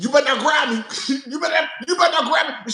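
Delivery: hammed-up fighting threat; mock yelling you better not grab me. Candy's fight threat reenacted.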